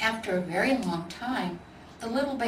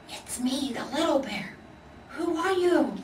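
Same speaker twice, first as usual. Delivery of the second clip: small bear voice; 7 semitones higher than usual. Acted. Little bear answers in the dark.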